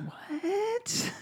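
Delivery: high startled squeak of disbelief; a stunned, breathy what; mock shock. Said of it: "And I went".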